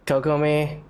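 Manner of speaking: normal talking voice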